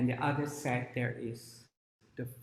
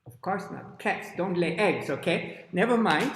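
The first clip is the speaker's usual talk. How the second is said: plain reading voice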